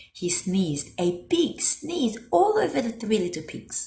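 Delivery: ordinary delivery, nothing marked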